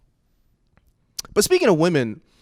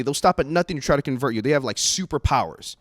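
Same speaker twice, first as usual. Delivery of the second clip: ordinary delivery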